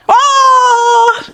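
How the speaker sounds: high startled oh; a sudden high gasp of surprise; surprised oh. Real, not acted. Reacting to child's play. (ऊँची आवाज़ में हैरानी भरा ओह)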